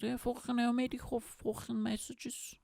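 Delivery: high-pitched comic Scouse accent. Shown as a demonstration. Joking about messages carried by pigeons, high Scouse accent. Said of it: "Little Scouse accent".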